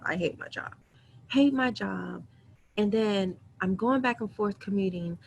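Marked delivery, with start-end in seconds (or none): 0.0-0.7 breathy whisper for emphasis. Shown as a demonstration. Confessing to viewers how much she hates her job, whispered. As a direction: drop to a secret-sharing whisper. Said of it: "When I say I hate my job".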